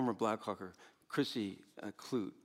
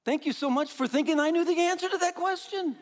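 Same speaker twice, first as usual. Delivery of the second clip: sarcastic thanks; 7 dB louder than usual. Acted. Sarcastic reply to the question.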